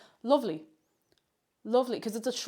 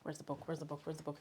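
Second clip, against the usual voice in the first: much softer, lower, to self; quiet muttering; 7 semitones lower, 16 dB quieter, faster than usual. Real, not acted. Looking for the book off mic, softly to herself. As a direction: mutter while searching.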